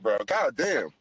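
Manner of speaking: usual speaking voice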